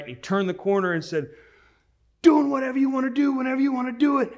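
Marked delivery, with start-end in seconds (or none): 2.2-4.3 high, loud, fast sergeant bellow; drill sergeant voice. Retold or imitated. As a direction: nail the sergeant's booming call. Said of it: "he got the voice just right".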